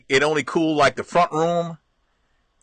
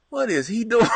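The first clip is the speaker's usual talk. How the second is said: put-on amused kids; 6 semitones higher than usual. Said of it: "sitting there going".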